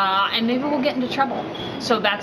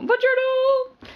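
high, drawn-out singsong buongiorno; singsong greeting; slower than usual. Pretend. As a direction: sing the greeting up high and slowly. Playful Italian greeting exchange.

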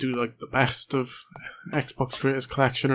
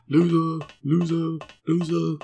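higher, drawn-out teasing chant; mocking taunt; 5 semitones higher, 4 dB louder, slower than usual. Player gloating at an in-game enemy, mocking taunt. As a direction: jeer it in a raised sing-song.

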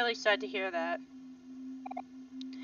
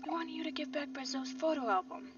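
high, thin, hushed voice; hushed whispering; 6 semitones higher, 6 dB quieter than usual. Acted. Former friends confronting each other in church, hushed whispering. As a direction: squeeze it out high and under your breath. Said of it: "I don't know why I'm whispering".

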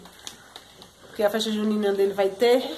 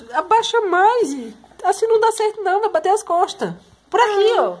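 raised, high-pitched parental instruction; loud and high, coaching urgently; urgent warning; 8 semitones higher, 5 dB louder than usual. Real, not acted. Mother urgently guides child under bed.

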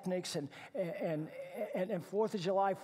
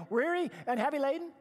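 animated repeat; 8 semitones higher, 7 dB louder than usual. Acted.

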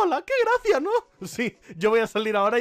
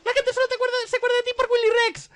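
high Pokémon taunt, rushed at the end; mock captivating voice; 4 dB louder, faster than usual. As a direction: needle them in a squeaky voice. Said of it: "voz cautivadora".

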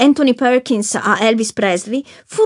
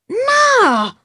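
a sudden high, far-off cry of no; shouted no; 13 semitones higher than usual. Acted. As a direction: fling the no out in despair. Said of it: "urlò".

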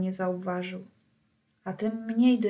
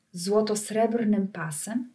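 plain reading voice

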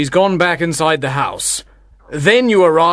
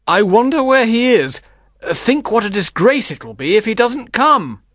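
lightly voiced anxious complaint; mildly put-out father; complaining; 3 semitones higher than usual. A narrator's reading. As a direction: fret aloud in a character voice. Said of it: "Baptista complained to Tranio".

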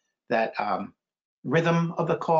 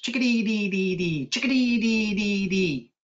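higher chanted call with repeated dees; chickadee call; 6 semitones higher than usual. A narrator's reading. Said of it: "Some birds say their name".

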